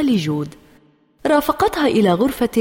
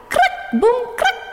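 high, sharp crack-boom-crack; spoken crash sound effect; imitated cracking crash. A narrator's reading. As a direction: make the noise of a branch breaking. Narrator imitates breaking branch and fall.

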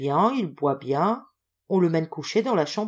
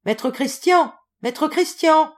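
high, insistent hailing; louder repeated call; 10 semitones higher, 7 dB louder, slower than usual. A narrator's reading. Visitor surprised by his friend's stillness, louder repeated call. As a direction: raise the voice to rouse him. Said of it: "répéta plus haut".

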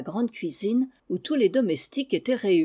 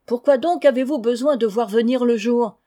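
raised voice calling across the stable; 4 semitones higher, 6 dB louder than usual. Acted. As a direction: call up to the men below, loud and puzzled. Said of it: "leur cria". (Goulven crie aux valets, voix forte et étonnée)

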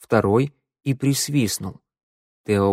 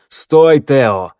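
louder urgent call after him; shout; 3 semitones higher, 9 dB louder than usual. A narrator's reading. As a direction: yell the order to halt. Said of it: "закричал змеевик". (окрик, громко)

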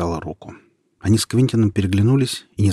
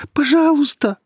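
desperate plea; 7 dB louder than usual. A narrator's reading. Boy begging over dying girl.

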